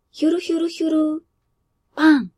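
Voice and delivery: 花火の音まね; firework whistle and bang. A narrator's reading. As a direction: mimic a firework going up and bursting. Narrator imitates a firework.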